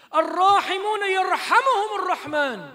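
loud, high ringing hadith; forceful recitation. Acted. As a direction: proclaim the hadith loudly.

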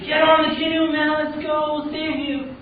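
high, loud rescuer's holler; acted call across the water; urgent calling out. Canoeists to man wading in flood, urgent high call.

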